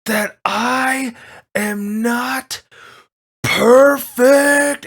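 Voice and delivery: villain screaming in fury. Acted. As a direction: scream PERFECT with everything. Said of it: "he starts screaming".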